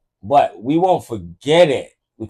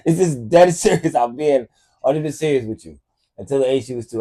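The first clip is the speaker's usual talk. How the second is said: ordinary talk, nothing marked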